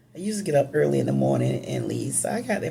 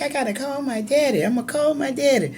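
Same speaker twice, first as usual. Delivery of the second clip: retold eager outburst, much higher and quicker; tipsy excitement; 10 semitones higher, faster than usual. At the party, eager to phone her daddy, excited. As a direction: mimic her past self bursting to phone him. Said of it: "I was like".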